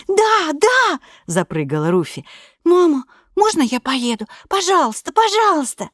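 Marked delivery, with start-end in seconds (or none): 0.1-1.0 put-on high, eager child's "yes, yes"; excited little girl. Ruthie jumps at the trip. 2.6-5.9 put-on high child's voice begging harder; pleading little girl. Child begs mother for trip.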